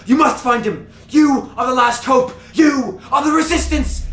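high, urgent prophetess pleading; yelling doomsayer. Acted. Ragged woman begs heroes to find the wizard, high frantic yelling. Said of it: "she's yelling out, like".

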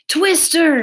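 loud, high warning cry; urgent holler; shout. A narrator's reading. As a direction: shout the alarm with a falling end. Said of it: "he shouted again".

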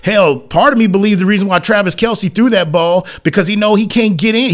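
ordinary talk, nothing marked

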